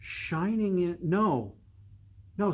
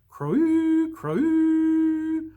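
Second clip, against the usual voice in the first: much higher, louder drawn-out call; shouted; 13 semitones higher, 6 dB louder than usual. Acted. Watchers shouting the boy's arrival. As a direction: bellow it long across the walls. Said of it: "Voices shouted".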